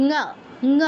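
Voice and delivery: ঙ as one syllable 'nguh', no leading u; isolated nasal ঙ, 'nguh'; correct Sanskrit nga. Shown as a demonstration. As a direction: back of the tongue on the throat for ঙ. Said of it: "উচ্চারণটা কীরকম হবে".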